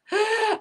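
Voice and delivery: quick high giggle; high laugh. Real, not acted. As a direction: giggle at the funny story. Laughs at the husband's back story.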